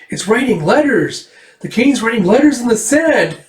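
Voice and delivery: put-on high, excited girl's cry; thrilled, high-pitched exclamation; excited discovery. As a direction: announce a find in a child's pitch. Erica discovers the writing.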